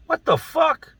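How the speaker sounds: high squeaky shocked guard's voice. Retold or imitated. Guard finds inmate wrapped in toilet paper, high shocked squeak. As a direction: squeal it in disbelief.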